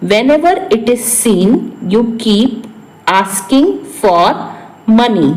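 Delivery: broken into pieces, slowing toward the end; phrase-by-phrase repeat. Shown as a demonstration. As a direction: dictate it in chunks to learners. Repeats translation in short pieces. (टुकड़ों में, ठहर-ठहर कर)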